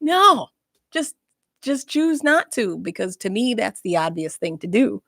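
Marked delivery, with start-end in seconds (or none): 0.0-2.8 exasperated. Retold or imitated. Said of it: "looking at these people like".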